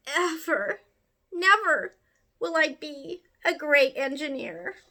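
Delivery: high, crying promise never to try again; a child's tearful vow of giving up; despairing vow. Acted. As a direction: near tears, high and hurried. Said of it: "who thought".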